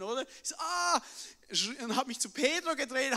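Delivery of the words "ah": high excited shout; joyful cry. Retold or imitated. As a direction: burst out in delight. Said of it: "meine Freude dann teilen".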